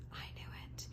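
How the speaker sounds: breathy hushed whisper of delight; whispering to viewers over an empath card. Real, not acted. Hushed whisper.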